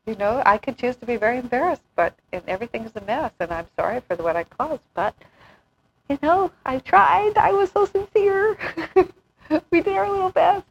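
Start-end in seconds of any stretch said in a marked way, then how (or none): 6.1-10.6 high, slow mock-earnest plea. Quoting carefree people in a high, slow, earnest voice. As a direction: play it as a proud, cheerful failure. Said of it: "people who can just openly and casually say".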